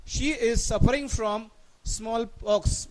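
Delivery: very slow, word by word; slow dictation. Shown as a demonstration. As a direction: dictate slowly to students. Teacher reads question slowly. (सावकाश वाचन)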